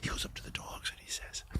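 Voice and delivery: hushed, voiceless whisper; secretive whispered setup. Acted. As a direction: drop right into a soft whisper. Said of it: "That's what he's whispering".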